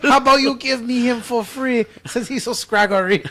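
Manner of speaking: put-on Chinese accent, bargaining; mock Chinese accent. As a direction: wheedle in a caricatured Chinese accent. Acts out buyer haggling.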